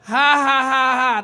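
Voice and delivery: loud hammed-up "Ha, ha, ha"; farmer's mock laugh. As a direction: spell out a big fake belly laugh. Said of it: "the farmer was rolling in laughter".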